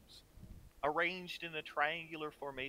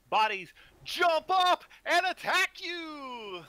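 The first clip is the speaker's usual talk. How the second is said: excited; 12 semitones higher, 8 dB louder, slower than usual. A narrator's reading. DM announces sudden attack.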